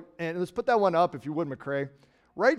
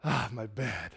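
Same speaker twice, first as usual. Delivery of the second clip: contented sigh; 5 semitones lower, 6 dB quieter, slower than usual. Retold or imitated. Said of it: "get under the covers and go".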